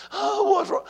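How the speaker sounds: very high, anxious cry; acted distress. Husband and wife on troubling call, high acted distress. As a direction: pitch it way up in mock distress.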